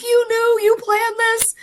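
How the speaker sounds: high, loud, teary surprise; stunned accusation. Retold or imitated. Wife to sister who planned the surprise, high stunned cry. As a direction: catch your sister in on the secret.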